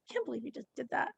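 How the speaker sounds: quick, soft exasperated remark; exasperated sarcasm. Shown as a demonstration. Sibling reacting to a stupid question, exasperated sarcasm. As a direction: rush it out under your breath, sarcastically exasperated. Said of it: "Exasperation and sarcasm often go together".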